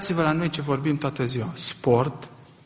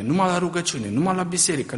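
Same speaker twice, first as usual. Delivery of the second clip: usual speaking voice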